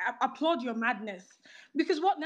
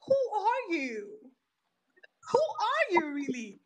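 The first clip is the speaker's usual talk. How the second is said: slow, higher-pitched scornful question; scornful challenge; 6 semitones higher, slower than usual. Real, not acted. Speaker challenging her critics, scornful and slow. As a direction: look down on them as you ask. Confronting the room.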